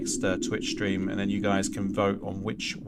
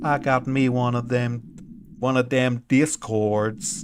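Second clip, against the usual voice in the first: put-on hillbilly accent; 2 semitones higher, 4 dB louder than usual. Streamer jokes to chat in a drawling hillbilly accent. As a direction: lay on a hillbilly accent, stretching the vowels. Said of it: "like, a hillbilly accent".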